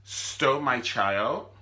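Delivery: Candace accusing. Retold or imitated. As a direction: drag out stole my child. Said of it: "she was like".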